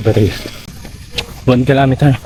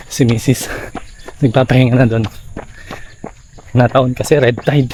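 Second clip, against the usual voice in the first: usual speaking voice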